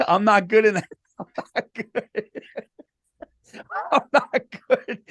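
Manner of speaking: helpless, breathy giggling through the words; laughing. Real, not acted. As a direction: break up laughing as you talk. Cracking up at own social awkwardness.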